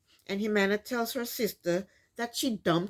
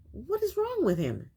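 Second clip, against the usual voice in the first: high-pitched, incredulous question; exasperated disbelief; 9 semitones higher than usual. Real, not acted. Vlogger baffled he thinks it's not over, high exasperated disbelief. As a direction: pitch leaps up, utterly baffled.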